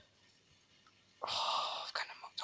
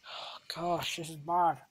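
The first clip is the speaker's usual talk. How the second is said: ordinary delivery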